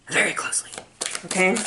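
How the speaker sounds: soft whisper; whispered warning. Pretend. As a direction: hush it like a secret. Whispered secret.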